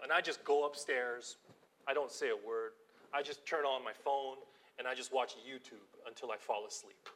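ordinary delivery

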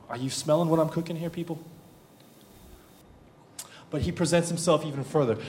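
ordinary talk

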